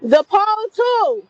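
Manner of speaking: loud, slow call across the yard. Real, not acted. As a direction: shout it at the people outside. Homeowner calling out at thieves.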